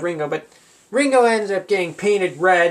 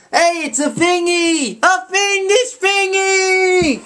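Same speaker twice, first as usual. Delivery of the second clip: very high, slow alarmed exclaim; George impression; 12 semitones higher, much slower than usual. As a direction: squeal it out, stretched and high. George voiced spotting a bomb.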